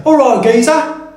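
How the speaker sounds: a cheeky Cockney greeting, pitched high; Cockney accent. Shown as a demonstration. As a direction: greet like a London geezer. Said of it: "Cockneys will say".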